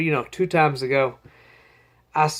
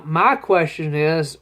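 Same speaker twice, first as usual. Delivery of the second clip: ordinary delivery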